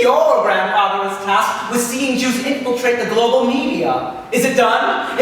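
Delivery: high, loud, ringing demon voice; booming Satan. Retold or imitated. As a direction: boom out a grand devilish greeting. Satan questions Menashe.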